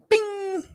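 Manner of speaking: high-pitched "bing" for an idea switching on; high ding. Retold or imitated. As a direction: imitate a sudden little chime. Idea strikes, imitated ding.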